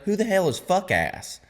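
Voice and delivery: high-pitched incredulous demand; retold bafflement. Buddy asking who the overheard name is, incredulous.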